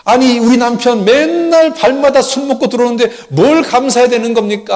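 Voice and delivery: acted indignant shout, high and loud; exasperated wife demanding answers, hammed-up; indignant arguing. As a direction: have it out with God at full voice. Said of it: "또 하나님께 따졌답니다".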